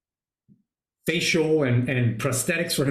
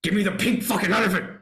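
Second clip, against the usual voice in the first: higher, fast retold outburst; furious demand; 5 semitones higher, faster than usual. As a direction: let the rage break through, landing on elephant. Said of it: "He's like".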